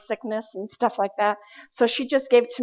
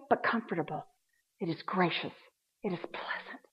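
hushed whisper; 5 semitones lower, 12 dB quieter than usual. Acted. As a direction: drop to a breathy hush. Softening Jesus' promise to hush.

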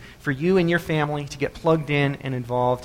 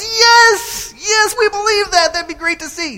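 very high excited yell, easing at the end; cheering crowd; 6 dB louder, slower than usual. Acted. Crowd asked about the wheelbarrow stunt, high excited shouting. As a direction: shriek agreement as an eager crowd. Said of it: "And the crowd goes".

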